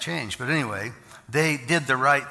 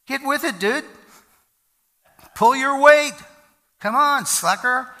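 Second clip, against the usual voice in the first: much higher and louder, impatient shout; mock yell at a slacker; 12 semitones higher, 10 dB louder than usual. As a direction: bark at the lazy guy to pull his weight. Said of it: "we're Americans and we wanna say".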